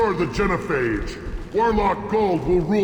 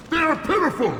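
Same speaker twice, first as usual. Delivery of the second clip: shouting; 8 semitones higher, faster than usual. Acted. Krogan chief ranting at enemies.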